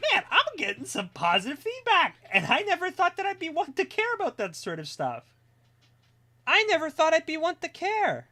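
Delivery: high, loud, thrilled outburst; giddy excitement. Real, not acted. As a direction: gush in a squeaky high pitch. Giddy over positive feedback on stream.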